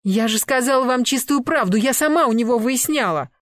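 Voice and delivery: high, loud woman's outburst; indignant protest. Acted. Girlfriend defending her man to the attackers, indignant protest. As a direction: insist loudly that you told the truth.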